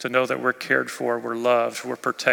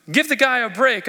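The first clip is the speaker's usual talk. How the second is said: pleading for Peter; 10 semitones higher, 5 dB louder than usual. Real, not acted. Preacher defends Peter aloud.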